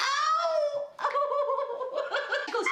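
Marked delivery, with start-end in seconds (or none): 0.1-0.5 high, loud "ow" through laughter; mock pain cry. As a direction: wail it out in exaggerated pain. Retold cry of pain raising arm.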